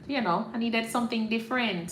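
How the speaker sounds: ordinary talk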